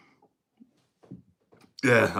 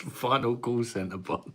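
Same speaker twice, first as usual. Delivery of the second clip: "Final call centre boss" said slower, higher, in a light Indian accent; slow mock call-centre boss voice on "Final call centre boss"; 4 semitones higher, 5 dB quieter, slower than usual. Shown as a demonstration. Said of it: "I sounded like this then".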